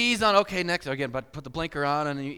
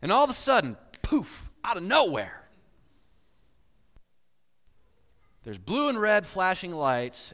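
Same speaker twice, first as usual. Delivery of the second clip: louder, slower, dramatic; dramatic build; 4 semitones higher, 6 dB louder, slower than usual. Acted. Preacher springing the police surprise, loud and slow. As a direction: land the surprise slowly. Sudden police lights appear.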